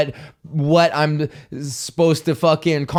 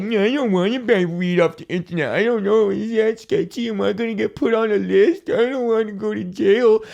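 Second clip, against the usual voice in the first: nervous first-time buyer; 6 semitones higher than usual. Retold or imitated. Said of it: "you were like".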